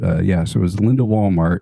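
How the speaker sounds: usual speaking voice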